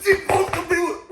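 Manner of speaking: high, drawn-out indignant yell; retold yell. Patient yells at staff taking the lady away, high protest. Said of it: "And I yelled, I said".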